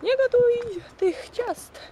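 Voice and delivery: high, loud, slow scolding yell. Retold or imitated. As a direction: drag it out like a nagging scold. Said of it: "krzyczą na mnie".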